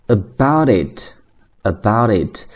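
"about it" run together as abou-dit; slow linked demo of "about it"; linked reading. Shown as a demonstration. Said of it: "它是在连读".

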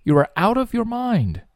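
mocking disbelief; incredulous. Shown as a demonstration. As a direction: scoff at a crazy idea. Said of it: "你呀，脑子有病吧".

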